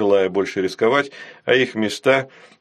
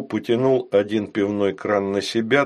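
usual speaking voice

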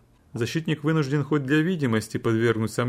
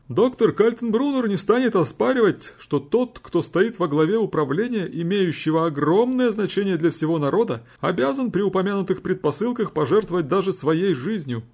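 high, solemn courtroom declaration; lawyer speaking in court; 7 semitones higher than usual. A narrator's reading. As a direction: declare it gravely to the judges. Lawyer's quoted speech.

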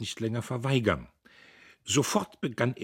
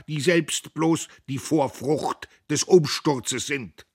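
higher, louder rousing speech; patriotic oration; 8 semitones higher, 6 dB louder than usual. A narrator's reading. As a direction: preach to the crowd at full force. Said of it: "rief Diederich".

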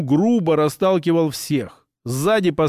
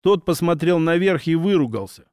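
ordinary delivery

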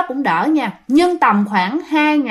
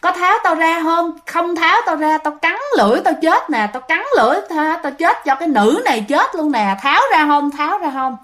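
high, insistent threatening cry; retold possessed yelling; 5 semitones higher than usual. Possessed girl to exorcist, high acted threatening yell. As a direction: imitate a possessed girl demanding release. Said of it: "chị tỉnh chị la".

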